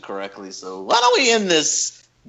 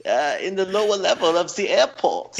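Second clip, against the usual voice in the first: put-on higher-pitched comic German accent; Dietrich, German accent; 4 semitones higher than usual. German bartender directing a customer to the airport store, German accent. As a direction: play a helpful German bartender. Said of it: "[name] has a very good Dieter, Dietrich impersonation".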